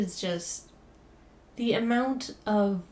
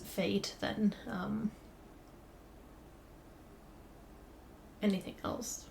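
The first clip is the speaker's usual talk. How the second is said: fading whisper; 11 dB quieter than usual. Real, not acted. Sad point trails off.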